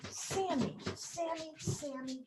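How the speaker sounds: high call across the room; calling the dog. Real, not acted. Teacher calls her dog.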